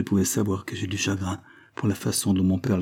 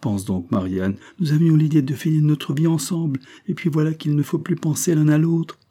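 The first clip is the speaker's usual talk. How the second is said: high, raised voice of heartfelt complaint; distressed exclamation; 7 semitones higher, 4 dB louder than usual. A narrator's reading. Sidonie laments her lost future with Michel, exclaiming. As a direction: plead your case in an urgent, higher voice. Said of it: "s'écria Sidonie".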